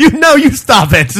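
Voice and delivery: high, loud laughing whine; whiny child. Acted. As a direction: shoot it back like a brat. Arguing with toy.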